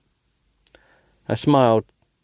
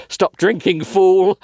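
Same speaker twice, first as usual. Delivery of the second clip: inner voice scream; 9 semitones higher, 4 dB louder, much slower than usual. Acted. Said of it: "voice from my subconscious screams".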